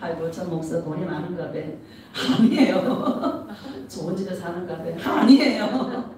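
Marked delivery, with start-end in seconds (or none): 0.0-1.6 mock gossip. 3.9-5.2 mock gossip.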